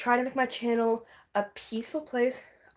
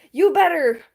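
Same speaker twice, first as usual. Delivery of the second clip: much louder teasing demand; louder insistence; 4 semitones higher, 7 dB louder than usual. Real, not acted. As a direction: push it harder. Urging louder.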